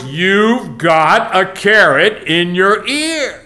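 loud, very slow, word by word. Retold or imitated. Fella to carrot-eared man, slow loud repeat. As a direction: boom it slowly at someone who can't hear. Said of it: "with even a louder voice".

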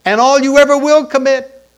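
loud, high cry on ever; preacher's rising shout; preaching shout. Acted. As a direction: belt it out to the back row. Preacher building to a climax.

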